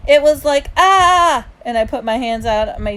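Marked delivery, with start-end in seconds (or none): 0.1-0.7 high, loud lead-in; eager demonstrating. A narrator's reading. Little brother sets up his demonstration, eager. 0.7-1.4 high, loud drawn "Ah!"; demonstrated yell. Acted. Little brother shows mom his noise, yelled. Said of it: "it wasn't a scream".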